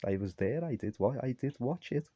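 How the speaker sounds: half-hearted mock calm reply; imitating the son. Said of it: "He says".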